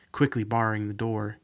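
ordinary delivery

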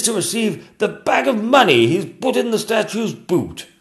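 high, loud reading aloud; excited king. Acted. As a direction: read out the find with delight.

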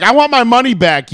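hammed-up angry customer; mock indignant demand. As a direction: act out demanding a refund. Acts out angry demand for refund.

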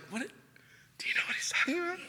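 high breathless whisper among disciples. Retold or imitated. Disciples whisper to each other about Jesus's words. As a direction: whisper it so Jesus can't hear. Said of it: "they're all whispering".

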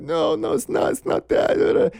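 high, breathy, broken sob-talk; imitating mom sobbing. Mom crying over good news, imitated as a high, broken sob. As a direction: mimic a mother crying too hard to get words out. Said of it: "you can't really understand what they're saying".

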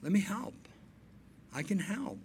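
1 gentle, soft offer. Retold or imitated. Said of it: "just say".

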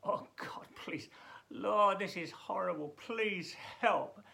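whispered then loud pleading cry; mock desperate plea; desperate prayer. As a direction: beg God for help in distress. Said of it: "I hope you do that".

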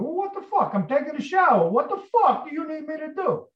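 very high, indignant yelling voice; exasperated outburst. Retold or imitated. Tony snapping at his friend from the shower, high exasperation. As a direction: act out an aggrieved man bothered mid-task. Acts Tony's angry reply in shower.

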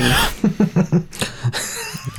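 unvoiced wheezing laugh; breathy laugh. Real, not acted. Laughing at the bit.

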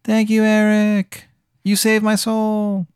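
high, slow, airy thanks; slow, high grateful spirit. Acted. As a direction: float the words up like a rising soul. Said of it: "and it goes".

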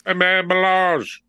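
high mock announcer. Mocking the DJ name.

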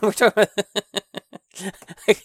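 breaking up into laughter mid-sentence; words dissolving into a giggle; real laughing. Real, not acted. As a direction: crack up while trying to get back on topic. Cracks up at own tangent.

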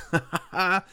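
short high burst of laughter; real laugh. Real, not acted. Preacher amused by the meat out their nostrils, laughing. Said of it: "Forgive me for laughing there".

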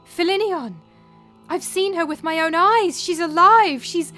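high, very loud, breathless insistence; excited cry. A narrator's reading. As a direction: cry it out, desperate to be believed. Nurse insisting daughter is alive.

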